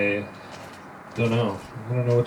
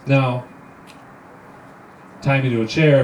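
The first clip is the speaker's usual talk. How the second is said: normal talking voice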